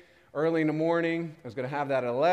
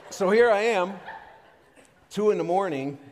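preacher's voice rising, much louder and higher; loud dramatic build; 7 semitones higher, 9 dB louder than usual. Real, not acted. Preacher telling the congregation about his hospital night, loud and intense. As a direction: hit it hard to grab the room.

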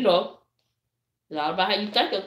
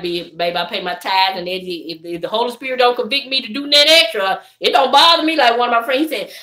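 ordinary delivery

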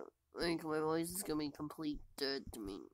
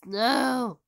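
frustrated shout; 6 semitones higher, 13 dB louder than usual. Real, not acted. Cries out again.

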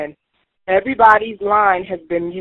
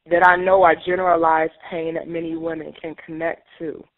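ordinary delivery

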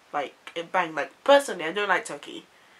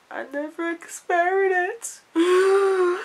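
drawn-out disbelief; 10 semitones higher, 3 dB louder, much slower than usual. Real, not acted. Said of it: "repeated, drawn out".

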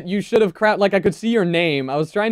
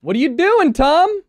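high surprised call; 8 semitones higher than usual. Real, not acted. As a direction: call across to him, pitch up. Calling out to a player.

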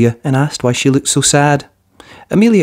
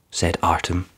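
usual speaking voice

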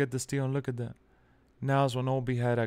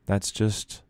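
resigned; 7 semitones lower than usual. Real, not acted. Resigned to the loss.

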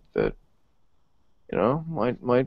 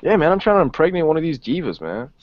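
ordinary talk, nothing marked

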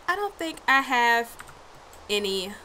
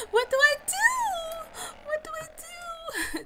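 panicked. Real, not acted. Panicking at deer.

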